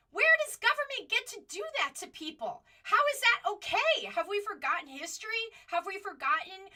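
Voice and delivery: loud, high-pitched outraged challenge; real indignation. Real, not acted. Commentator protesting government treatment of crying mothers, indignant.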